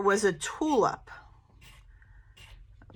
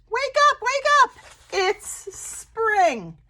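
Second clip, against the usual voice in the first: birds calling; 12 semitones higher, slower than usual. Acted. Birds call to the bears.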